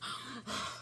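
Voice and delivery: heavy audible exhale, mimicked; mock heavy breathing; imitated deep breathing. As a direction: blow out a big breath like the braider. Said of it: "the lady kept taking deep breaths".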